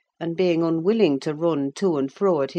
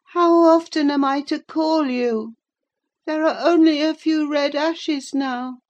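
put-on higher, louder complaining invalid boy; peevish sickly boy; 11 semitones higher, 4 dB louder than usual. A narrator's reading. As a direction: whine at the servant who ignores you. Linton calls Joseph peevishly.

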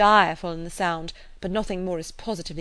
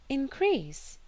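higher, surprised echo of one word; questioning echo; 7 semitones higher, 3 dB quieter than usual. A narrator's reading. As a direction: query the word in mild disbelief. Emma queries John's claim.

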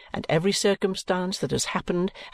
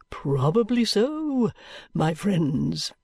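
high, slow, unctuous sermonizing voice, drawn out; a sleek preacher savouring every rhetorical question; oily preacher; 3 semitones higher, much slower than usual. Acted. Chadband preaching to the gathered company in a high, slow, oily voice.

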